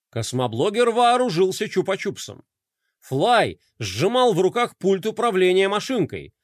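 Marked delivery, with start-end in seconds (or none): none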